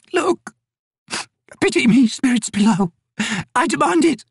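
put-on high, quavering plea of a frail old woman; desperate old woman pleading. Old Maibi begging the spirits below for mercy, high desperate plea. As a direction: beg the spirits for mercy, rising to a cry.

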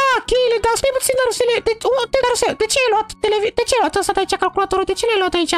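squeaky high cartoon voice, fussing; a high, excited toy character scolding his friend. (voce de personaj ascuțită)

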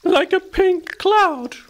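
very high, drawn-out squeak; slow squeaky mouse voice, lightly amused. A narrator's reading. Field mouse teases petal-covered hedgehog, amused. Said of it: "laughed Felicity".

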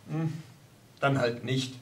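low, soft, put out; sulky mumble. Acted. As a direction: grumble it under your breath. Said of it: "murmelte Hans verstimmt".

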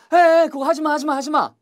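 high, insistent plea to stop; hey, cut it out, loud at first; acted protest. Acted telling someone not to.

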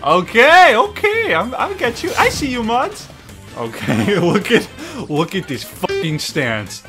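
ordinary delivery

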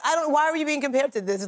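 ordinary talk

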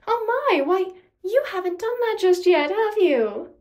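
high, loud mock astonishment; mock-surprised villainess. A narrator's reading. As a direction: feign shock to taunt your rival. Nemesis feigns surprise to taunt.

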